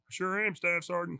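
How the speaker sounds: high, soft, slow voice of a radio operator; imitating Bill. Marine answering his staff sergeant after being shot at, imitated high and soft. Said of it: "'cause that's how he sounded".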